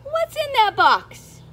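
ordinary delivery

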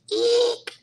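very high, loud "eek"; short piercing squeal; excited squeal. Real, not acted. Squeal about orange shoes.

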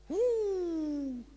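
high ecstatic woo, real joy; excited whoop. Real, not acted. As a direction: let out a happy holler. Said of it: "I'm getting a little excited".

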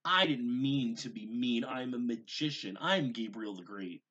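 put-on loud childish protest; yelled. A narrator's reading. Gabriel yelling from the stool at his mother, loud protest. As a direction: stomp and shout it out. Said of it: "he yelled".

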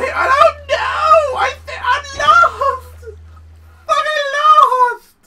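high, slow, loud half-laughing cry; lost in the game, shrieking and laughing; panicked wail. Real, not acted. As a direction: wail in exaggerated despair.

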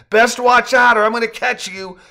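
high, loud teasing call; loud, gleeful taunt; playful shouted warning. A narrator's reading. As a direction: holler a mock threat after them. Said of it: "with a laugh".

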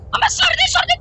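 sudden high playful yell; mock yell. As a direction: yelp the word out in a joke voice. Joking meme voice, sudden yell.

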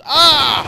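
loud, high "aah" of fright; sudden yell as you're jumped; sharp cry of alarm; startled scream. Real, not acted. Startled by enemy.

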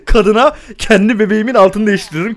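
usual speaking voice

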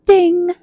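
high, ringing ding; drawn-out bell noise; imitated ding. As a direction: chime like a washer timer. Imitates washer's finished chime.

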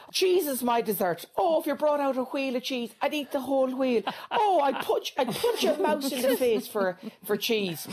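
hammed-up cheese obsessive; mock cheese lover. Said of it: "those people".

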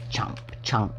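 soft whispered munching noise; whispered chomping. Acted. Storyteller voicing the goat eating the book, whispered chomps. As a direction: whisper the chewing sound like a hungry goat.

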